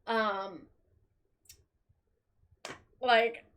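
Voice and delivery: ordinary talk, nothing marked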